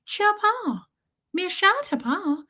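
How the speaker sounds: very high squeaky mock-French babble; French-sounding pet voice. Shown as a demonstration. Owner flipping to a different language for Gertie, squeaky French-like baby talk. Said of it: "it almost sounds French".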